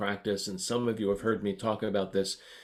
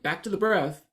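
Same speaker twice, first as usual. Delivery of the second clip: higher, louder, scolding school principal; 8 semitones higher, 5 dB louder than usual. Retold or imitated. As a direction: order them back like a strict principal. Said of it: "like a junior high school principal going".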